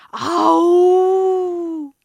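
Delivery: loud, long, rising howl-roar; drawn-out lion "awoo". Acted. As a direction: roar to scare someone out of their wits. Lion leaps out to scare.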